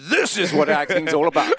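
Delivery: high, loud, hammed-up gushing actor. Mocking actors on set, high loud gushing. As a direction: gush about acting like a luvvie. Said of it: "I went".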